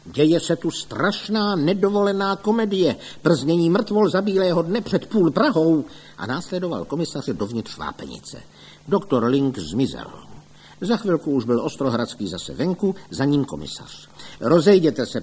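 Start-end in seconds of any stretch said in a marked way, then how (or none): 0.0-5.8 high, outraged declaiming; scandalized public denunciation; indignant complaint. A narrator's reading. 14.4-15.2 loud, raised command; firm higher call; official order. A narrator's reading. Commissioner orders crowd to disperse.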